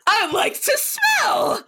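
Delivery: high, slow laughing echo; mocking echo. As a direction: echo it back drawn out, through laughter. Teasing echo of co-host's line.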